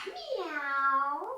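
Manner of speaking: high rising kitty meow; playful meow up high; cat meow. Shown as a demonstration. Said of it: "Keep meowing if that feels okay".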